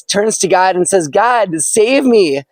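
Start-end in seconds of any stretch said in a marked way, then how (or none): 1.1-1.6 a mild nervous plea, pitched up; pleading prayer. Acted. Stranded man begs God to save him, mildly pleading. 1.6-2.4 higher, quick mild plea; nervous plea. Acted. Man on rooftop first asks God to save him, mildly nervous.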